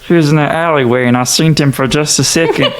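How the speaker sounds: high-pitched comic townsperson; eager bystander. Acted. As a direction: pipe up from the crowd. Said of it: "the same guy go".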